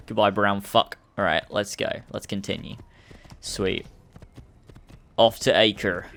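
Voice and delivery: ordinary talk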